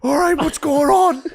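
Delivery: very high, loud flustered voice. Retold or imitated. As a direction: babble it in drunk confusion. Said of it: "He was like".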